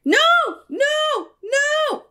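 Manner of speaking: high, loud horrified shouts; shouting no. Real, not acted. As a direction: yell it out in dismay. Reacting in horror to movie.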